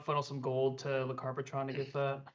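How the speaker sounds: normal talking voice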